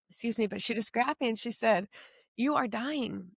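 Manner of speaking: holding back tears while telling it; close to tears. Real, not acted. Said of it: "and I'm gonna cry".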